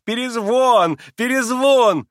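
high, loud, drawn calls; calling the dog. A narrator's reading. Amused mother summoning the dog, high calling voice. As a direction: call out as if coaxing a dog up. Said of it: "принялась щёлкать пальцами и звать".

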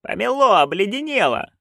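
high-pitched cry of alarm; narrated wail. A narrator's reading. Witch crying out about her iced-up broom, wailing. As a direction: wail it high, without added volume. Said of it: "вопила матушка".

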